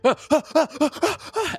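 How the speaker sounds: rapid very high "ah" cries; hammed-up panicked yelping; mock pained yelps. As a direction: yelp as if being scratched. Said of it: "And the guy's like".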